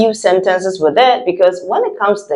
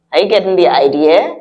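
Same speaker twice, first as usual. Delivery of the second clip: normal talking voice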